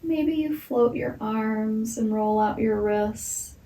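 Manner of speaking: usual speaking voice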